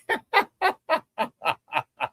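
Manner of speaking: long high wheezy laugh falling away; helpless laughter, falling in pitch. Real, not acted. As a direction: crack up laughing. Laughing at a chat message.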